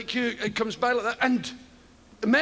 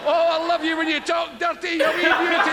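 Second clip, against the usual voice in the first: hammed-up lusty shepherd, higher and louder; randy shepherd; 6 semitones higher, 4 dB louder than usual. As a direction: croon it at the sheep, over the top. Shepherd answering with sheep bleats.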